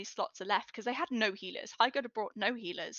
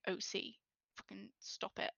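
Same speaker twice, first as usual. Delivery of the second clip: softer, weary out-of-character plea; exasperated undertone; 10 dB quieter than usual. Retold or imitated. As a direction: drop the voice like a fed-up aside. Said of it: "put your hand to your face".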